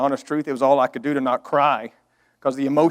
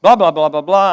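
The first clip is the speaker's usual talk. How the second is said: louder mock rant, blah blah; retold hollering; 3 semitones higher, 7 dB louder than usual. Son ranting at his dad over the wheat, hollering. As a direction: rattle it off hollering. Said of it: "I'm hollering".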